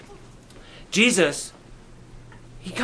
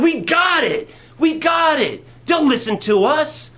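eager pleading; 6 semitones higher than usual. Retold or imitated. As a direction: beg him, thrilled and sure. Disciples pleading with the Lord.

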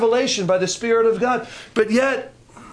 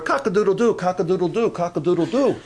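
mock cock crowing with rising ends; rooster crow imitation. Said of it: "And you remember".

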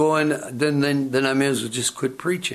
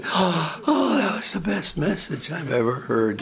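mock gushing; 5 semitones higher than usual. Admirer gushing over a sermon, acted.

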